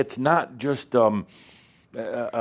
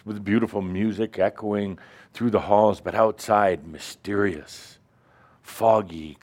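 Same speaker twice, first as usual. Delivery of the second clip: ordinary delivery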